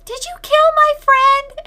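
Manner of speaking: put-on high, loud, accusing old woman's cry; shrill accusing old lady. Old lady confronting Rosemary over her friend, loud accusing cry.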